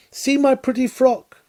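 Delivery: put-on high, sing-song little child voice; childish show-off. Reader mimicking a little child showing off new clothes, high childish voice. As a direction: squeal it like a child proud of a new dress. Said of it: "like little children ... who say".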